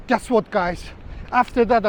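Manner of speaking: normal talking voice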